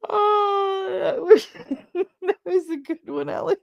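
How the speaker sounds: high squeak through laughter; real helpless laughter. Real, not acted. Reader praising a good one, helplessly laughing. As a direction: laugh so hard the words barely escape. Cracking up at a joke.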